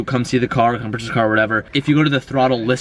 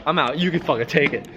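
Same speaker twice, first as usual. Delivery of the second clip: normal talking voice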